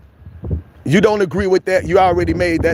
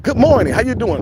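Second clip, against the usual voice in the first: higher, cheery hello; bright greeting; 6 semitones higher than usual. Retold or imitated. Greeting a grumpy neighbour, bright and cheery. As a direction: sing out a sunny good morning. Friendly greeter in acted scene.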